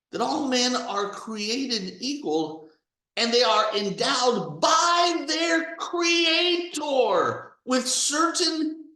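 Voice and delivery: slow, solemn, lifted voice quoting the founders; solemn recitation. Retold or imitated. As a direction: recite it like a sacred founding text, slowly. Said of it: "it goes something like this".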